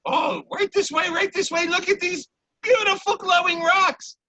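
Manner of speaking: high, eager merchant voice showing off wares; high chirpy little shopkeeper. Acted. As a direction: lure them in with a sing-song sales pitch. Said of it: "he goes".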